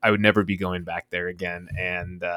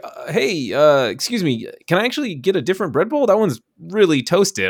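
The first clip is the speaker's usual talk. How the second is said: higher, hesitant polite request; polite complaint; 8 semitones higher than usual. Retold or imitated. Customer to Quiznos worker, politely higher and hesitant. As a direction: ask nicely but firmly for new bread. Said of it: "I was like".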